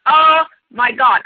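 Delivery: slow, drawn-out mock horror; mock dismay. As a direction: groan at the word God in disbelief. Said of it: "And I was like".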